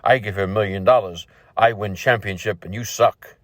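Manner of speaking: put-on low voice with a Russian accent; Russian big shot boasting in a low accent. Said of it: "in the Russian accent".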